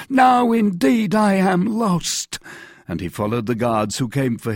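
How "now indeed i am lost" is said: high, loud, slow despairing wail. A narrator's reading. Poor mercer sure he is doomed, despairing. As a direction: draw out the hopeless lament. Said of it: "murmured the poor mercer".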